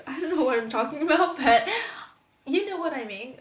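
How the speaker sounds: laughing. Real, not acted. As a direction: laugh it out at your own rambling. Laughs at own rambling.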